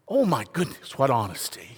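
ordinary talk

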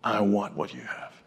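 a hint of a solemn giant asking quietly; serious big man. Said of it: "he had a very serious look".

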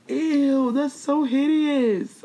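high, drawn-out squeal of revulsion; exaggerated disgust. Reacting to a YouTuber's looks, hammed-up disgust. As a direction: stretch it out, high and grossed-out.

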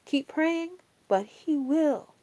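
normal talking voice

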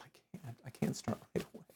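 unvoiced whisper, quick. Telling Cher he can't, whispered. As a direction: breathe the refusal.

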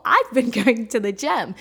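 laughing through it; amused laughter mid-line. Real, not acted. Laughs at her own rule.

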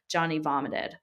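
ordinary delivery, nothing marked

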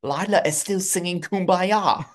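mock sing-song. Joking voice of Lila.

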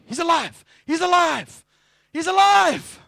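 high, loud cry of joy; jubilant shout. Acted. Disciples announcing the resurrection, jubilant shouting.

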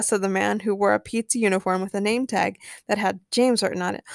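ordinary delivery, nothing marked

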